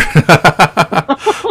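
high, loud laugh burst. Real, not acted. Laughing at own joke.

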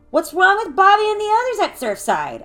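high, loud, pointed question; indignant challenge. A narrator's reading. Alyssa challenging Lucia about the boys, indignant.

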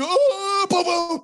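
very high, loud wail with booms; hammed-up scream and bang noises; mock panicked firing. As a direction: imitate wild panicked gunshots. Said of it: "I'm the guy like".

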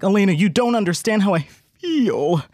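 overwrought brooding lover; melodramatic actor. Retold or imitated. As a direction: ham up the heartfelt confession. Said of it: "he's like".

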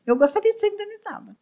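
high, fast mock-formal complaint; playful fake grievance, quick and high; mock demand. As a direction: demand damages in a jokey high voice.

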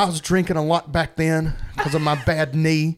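lightly voiced Southern mama, laughing through it; Southern mama impression. Podcaster quoting Verna to friends, light Southern voice while laughing.

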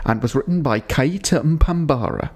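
ordinary talk, nothing marked

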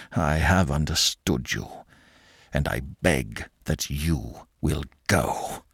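lightly voiced old uncle, slow and soft; cold, measured send-off; curt dismissal. Uncle telling André to leave, a hint of icy.

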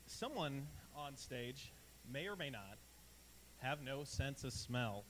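slow it down for the tease; slow teasing. Real, not acted.

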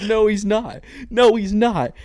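loud, high-pitched outburst of refusal; panicked denial. Retold or imitated. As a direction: retell it as a yelled, horrified objection. Repeats retold panicked denial.

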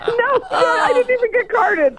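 high, laughing through the words; cracking up as she tells it. Real, not acted. Laughing at her own story.

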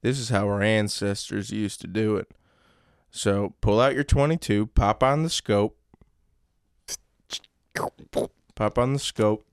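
Southern father teaching his son; Southern accent. Acted. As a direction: instruct like a country dad with a Southern accent. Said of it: "Southern accents?".

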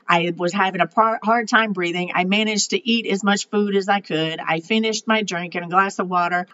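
normal talking voice

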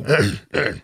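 mimicked throat-clearing grunts; repeated throaty grunts, imitated; imitated grunting noises. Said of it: "making all these noises and shit".

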